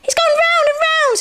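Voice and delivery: very high, loud little voice; much impressed. A narrator's reading. Roo watches Eeyore in the eddy, much impressed. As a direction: squeal it in wonder. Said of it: "much impressed".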